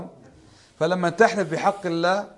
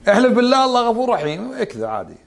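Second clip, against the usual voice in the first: mock careless believer; 7 semitones higher than usual. Mimicking careless oath-breakers.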